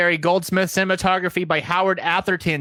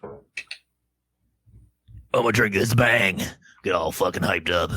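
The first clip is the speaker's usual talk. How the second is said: very low mock brute voice; Hills Have Eyes cannibal; 10 semitones lower than usual. Podcaster about to drink a Bang, cannibal voice. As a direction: drop it way down like a movie savage. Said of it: "was that your, uh, Hills Have Eyes cannibal voice".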